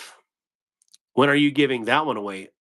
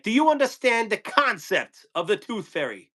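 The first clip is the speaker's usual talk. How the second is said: doing Walken's tooth fairy speech, pitched up; Christopher Walken impression; 8 semitones higher than usual. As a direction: lean into the odd Walken pauses. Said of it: "And he's like".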